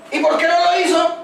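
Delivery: a lightly acted parent demanding why; mock scolding parent.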